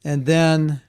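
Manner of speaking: ordinary delivery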